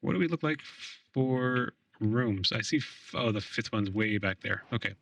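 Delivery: ordinary delivery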